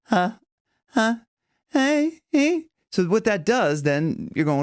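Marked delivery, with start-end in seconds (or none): 0.1-2.7 light onset huh. Shown as a demonstration. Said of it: "hearing that".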